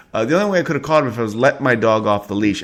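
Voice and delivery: usual speaking voice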